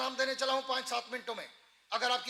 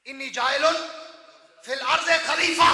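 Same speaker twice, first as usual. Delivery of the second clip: verse rising into loud preaching; louder recitation; 3 semitones higher, 11 dB louder than usual. Shown as a demonstration. Preacher repeating the verse louder to the crowd. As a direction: lift the verse up and out.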